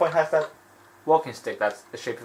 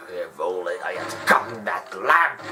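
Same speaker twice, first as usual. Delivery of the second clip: lower, slow villain voice, a touch of mock regret; Jafar regretting; 6 semitones lower than usual. Jafar bemoaning the lost lamp, in a low mock-villain voice. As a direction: brood like a defeated sorcerer. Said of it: "He was like".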